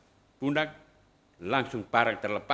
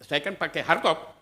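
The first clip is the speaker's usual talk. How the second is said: ordinary talk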